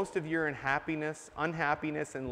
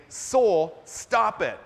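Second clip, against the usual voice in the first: loud, sharp command to his soul; firm scolding of one's own soul; stern rebuke; 4 semitones higher, 8 dB louder, much slower than usual. Retold or imitated. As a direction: stop yourself hard. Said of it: "a much stronger way of saying".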